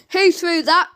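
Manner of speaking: loud, demanding question. A narrator's reading. Judge demanding the court tell who threw the egg. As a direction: insist on an answer now. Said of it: "demanded the judge".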